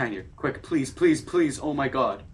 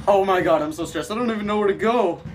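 panicked; 6 semitones higher, 5 dB louder, faster than usual. Real, not acted. Stressed gamer panics.

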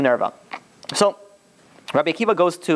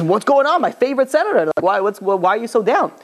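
fake friendliness; 7 semitones higher than usual. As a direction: greet him like a best friend while plotting. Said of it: "becoming very friendly to Nerva".

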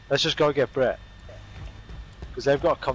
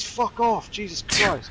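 panicked swearing; 8 semitones higher, much slower than usual. Real, not acted. Swarmed by zombies.

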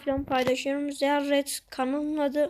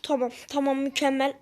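ordinary delivery

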